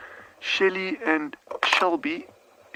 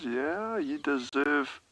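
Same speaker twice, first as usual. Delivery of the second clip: usual speaking voice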